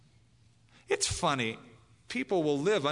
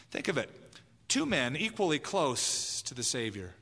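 ordinary talk, nothing marked

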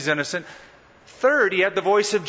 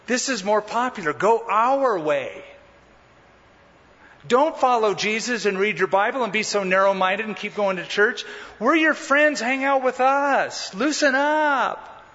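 high, coaxing mock voice of peer pressure; crowd's voice; 5 semitones higher than usual. Crowd telling you to go their way, high mocking coax. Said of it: "The voices of the crowd telling you".

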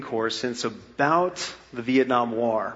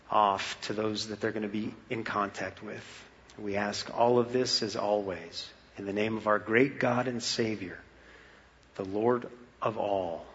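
prayer; 4 semitones lower, 5 dB quieter than usual. Acted. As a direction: pray quietly and earnestly. Pastoral prayer.

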